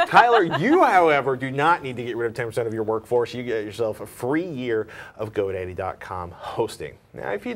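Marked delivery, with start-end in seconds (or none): 0.1-3.3 loud, emphatic "you, however"; mock boss pronouncement. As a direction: boom it like a boss sparing him.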